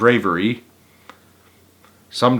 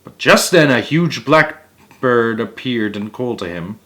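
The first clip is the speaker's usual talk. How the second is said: usual speaking voice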